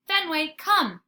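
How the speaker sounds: high, loud firm call; girl calling her dog again. Acted. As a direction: command the dog to come. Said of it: "she calls again".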